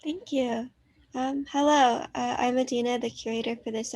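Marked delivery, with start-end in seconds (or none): none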